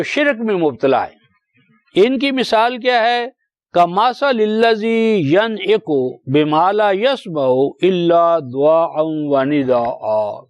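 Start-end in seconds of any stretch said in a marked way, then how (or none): 3.7-10.4 slow, drawn-out Arabic recitation; slow chanted Quranic Arabic. Acted. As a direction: intone the verse slowly and reverently.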